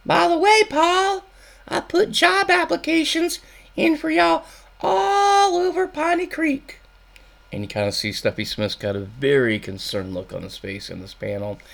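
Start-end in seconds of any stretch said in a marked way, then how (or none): 0.0-6.8 the wife announcing news in falsetto; Louise's voice. Acted. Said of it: "this is Louise speaking".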